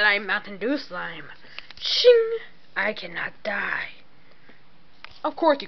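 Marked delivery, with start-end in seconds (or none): 1.8-2.4 high mock blade ring; sword shing sound effect. Sound effect in toy fight. 2.7-3.9 breathy whispered boast. Acted.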